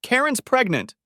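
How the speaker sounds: high, loud reproach; shrieking reproach. Acted. Wife reproaching her husband for calling, upset. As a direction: cry out at him in dismay.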